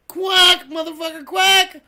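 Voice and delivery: high, loud quacking command; loud mock-aggressive egging on; urging quack. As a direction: goad the duck with punched quacks. Urges the racing ducks on.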